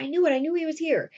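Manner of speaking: high, loud retold outburst; excited exclaiming. Friends come running in to find Cyrano, exclaiming.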